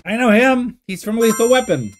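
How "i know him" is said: ordinary delivery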